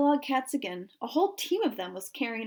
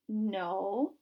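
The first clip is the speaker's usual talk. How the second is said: soft, low, drawn-out answer; hesitant, lingering little reply; said slowly; 4 semitones lower, 6 dB quieter than usual. A narrator's reading. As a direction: stretch it out softly and reluctantly. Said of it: "Hughie said slowly".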